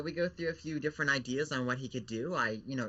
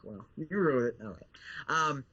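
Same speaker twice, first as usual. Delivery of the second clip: usual speaking voice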